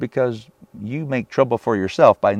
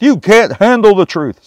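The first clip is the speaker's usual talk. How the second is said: high, loud movie-quote outburst; hammed-up courtroom-style shout; Jack Nicholson; 9 semitones higher, 6 dB louder than usual. Preacher throwing a film line at King Ahab, hammed-up outburst. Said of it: "Sorry, Jack Nicholson".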